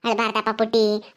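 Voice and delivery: low, slow comforting; tender soothing. Acted. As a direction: soothe a crying little one. Elder comforting a crying baby.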